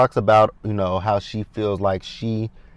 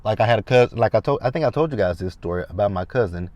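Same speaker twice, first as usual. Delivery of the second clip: usual speaking voice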